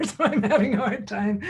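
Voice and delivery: laughing through the words. Real, not acted. Host laughing at the lively chat, laughing lightly. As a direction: chuckle while admitting the distraction.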